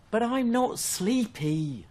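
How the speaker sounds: put-on high, slow childlike whine; a cub pleading he isn't tired; little fox. Little fox protesting to his mother, high and slow. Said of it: "little fox said".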